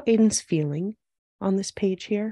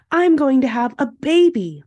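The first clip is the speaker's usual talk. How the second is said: slightly raised, proud-sounding announcement; announcing; 4 semitones higher, 7 dB louder than usual. A narrator's reading. Mom telling Aiden she's having a baby, announcing. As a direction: announce it a little higher and louder. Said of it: "Mom announced".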